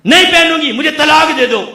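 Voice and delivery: high, loud mocking of a rebellious wife; defiant wife. Preacher mimics daughters refusing and demanding divorce, loud. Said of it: "जो अलम बगावत उठाई हुई".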